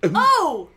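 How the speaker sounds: loud pained exclamation; pained cry. Acted. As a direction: cry out in pain. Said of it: "she said aloud, hurting all over".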